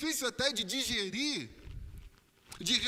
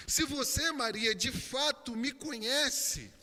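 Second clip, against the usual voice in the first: higher, forceful questioning as Jesus; insistent preaching; 5 semitones higher, 5 dB louder than usual. Acted. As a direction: press each question on her, phrase after phrase. Preacher voices Jesus questioning Mary. (pregação insistente em voz mais alta)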